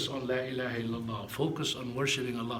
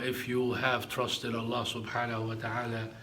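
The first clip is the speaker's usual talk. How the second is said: ordinary delivery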